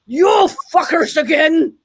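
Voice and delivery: put-on slow, indignant Scottish accent. Comedian voicing a reunited cat's look, in a Scottish accent. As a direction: attempt a drawn-out Scottish accent. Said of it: "That was my Scottish accent".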